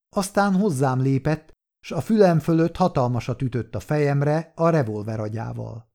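ordinary delivery

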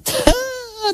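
high giggle of disbelief; bright laugh of surprise; delighted laugh. Acted. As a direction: let a startled laugh escape. Seeing the crowd in square.

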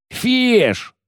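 very high, bellowed name; furious yell. A narrator's reading. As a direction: yell after the deserter. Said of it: "заорал Коббин". (яростный крик)

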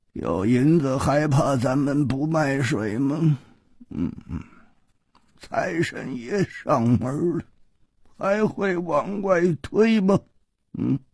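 unhurried, teasing innkeeper voice; sly shopkeeper. A narrator's reading. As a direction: toy with the customer, stretching the words. Sly shopkeeper toying with customer.

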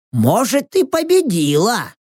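Rox. A narrator's reading. Рокс guesses about the contest.

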